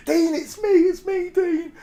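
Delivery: hammed-up frightened begging; mock panicked plea. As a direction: squeal it like a terrified lad. Pleading with drunk mate retold.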